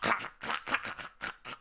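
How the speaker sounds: loud, mocking cackle; bird cackle. Bird laughing at Milo, loud cackle. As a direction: cackle at your own joke as a bird.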